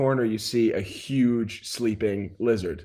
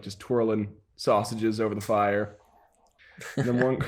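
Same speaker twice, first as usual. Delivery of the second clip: normal talking voice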